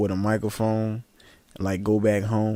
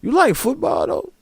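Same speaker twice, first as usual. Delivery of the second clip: dodging question; 13 semitones higher than usual. Retold or imitated. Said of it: "yeah, I got a family".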